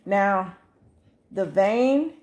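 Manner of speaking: normal talking voice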